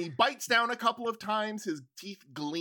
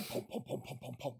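soft footsteps sound; 7 semitones lower, 13 dB quieter, faster than usual. Acted. GM makes footstep noise.

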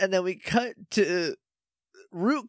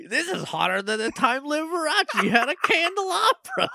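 high, comic wisecracking impression; Gex; 9 semitones higher than usual. Imitating the villain as weird Gex, high quipping voice. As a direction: deliver the one-liner pitched up, hammed up. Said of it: "he talks like a weird Gex".

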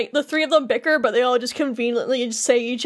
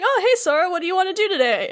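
put-on higher-pitched kids' hello; imitated cheery greeting; 6 semitones higher, faster than usual. Sora's friends run up to him, imitated cheery greeting. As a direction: greet a pal brightly, pitched up.

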